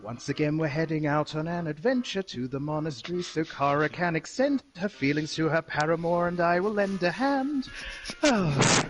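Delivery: rhyming recap read like a bard's letter; theatrical verse reading. Acted. Player reading his bard's rhyming diary recap to the group, lightly theatrical. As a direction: recite it as a romantic troubadour.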